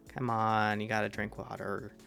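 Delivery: usual speaking voice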